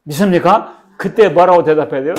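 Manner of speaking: ordinary talk, nothing marked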